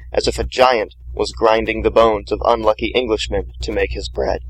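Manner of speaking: ordinary delivery, nothing marked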